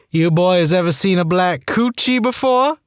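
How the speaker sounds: attempted sexy voice. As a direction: badly attempt a sultry come-on, drawn out. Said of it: "says in an attempt at a sexy voice".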